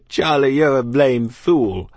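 loud, slow taunt; mocking soldier. A narrator's reading. Soldier mocking Charlie over potato, loud. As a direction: jeer at your comrade, drawn out.

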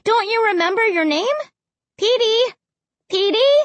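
high, coaxing call; high-pitched, questioning calls; calling to a dog. A narrator's reading. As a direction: plead with the dog to respond. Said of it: "I called to the dog".

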